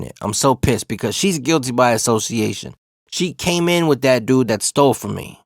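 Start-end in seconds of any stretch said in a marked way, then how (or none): none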